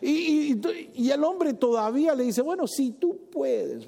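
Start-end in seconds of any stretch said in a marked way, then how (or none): 2.4-3.9 hesitant doubt. Acted.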